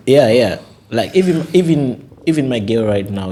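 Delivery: high, loud laughing burst; giggly, amused protest. Real, not acted. Laughing at gold digger joke.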